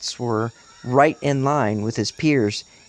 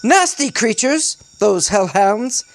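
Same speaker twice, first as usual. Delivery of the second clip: high, theatrical British exclamation; animated British character voice, pitched up; forced British accent; 7 semitones higher, 4 dB louder than usual. A narrator's reading. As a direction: declaim it high in put-on British. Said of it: "I'm gonna reread that".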